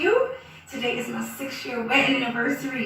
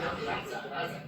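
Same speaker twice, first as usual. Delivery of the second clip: breathy whispered apology; whispering a playful apology; whispered sorry; 8 dB quieter, faster than usual. Acted. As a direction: drop to a whisper, mock sorry. Mock apology after a joke.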